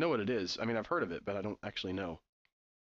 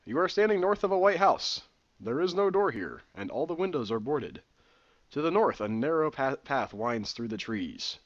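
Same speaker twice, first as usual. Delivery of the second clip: steady adventure narrator read; reading the room description aloud; game text narration; 5 dB louder than usual. Acted. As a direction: set the scene as the game narrator.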